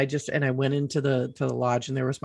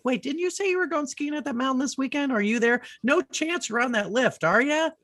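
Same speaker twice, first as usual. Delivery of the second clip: high-pitched retold disbelief; imitating worried friends, pitched way up; incredulous friends; 11 semitones higher than usual. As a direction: ask in a high, amazed voice. Friends' alarmed texts acted out.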